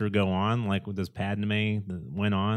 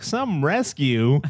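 loud, slow, higher-pitched complaint; acted-out sarcastic grumble; mock exasperation; 5 semitones higher, 5 dB louder, much slower than usual. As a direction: draw it out in scornful disbelief. Acting out Leia's scornful line.

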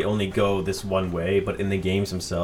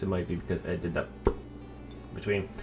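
ordinary talk, nothing marked

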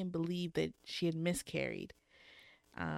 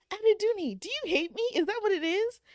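high, loud pleading disbelief; mock exasperation; 13 semitones higher, 6 dB louder than usual. Reader scolding the book's heroine, mock exasperation. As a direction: confront her in hammed-up dismay.

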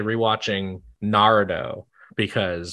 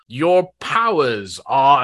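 put-on half-committed British accent; 2 semitones higher, 4 dB louder, much slower than usual. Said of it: "didn't full commit to a British accent".

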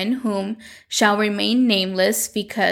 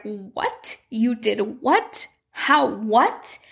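mock shock; much slower than usual. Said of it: "And Carlos Daniel is like".

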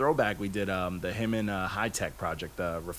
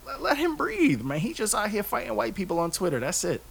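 passionate plea. Real, not acted. Passionate defense, high pitch.

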